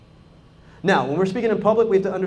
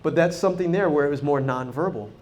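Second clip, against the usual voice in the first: ordinary delivery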